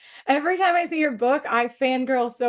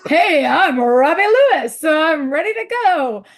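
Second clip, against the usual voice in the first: Robbie Lewis impression; 4 semitones higher, 6 dB louder than usual. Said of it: "'Cause I was like".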